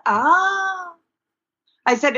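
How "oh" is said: a sudden high startled oh; surprised oh. Retold or imitated. Owner spots the possum in the tree, startled high oh. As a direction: spot the possum and gasp out a high oh. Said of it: "all of a sudden she goes".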